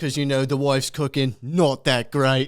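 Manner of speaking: British geezer, dragging the last words; imitated British food vlogger; mock British accent. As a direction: put on a British working-class accent. Quoting the British video guy.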